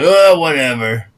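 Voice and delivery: high, slow, bored brush-off; dismissive guard. Acted. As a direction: wave him off lazily. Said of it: "And the guy's like".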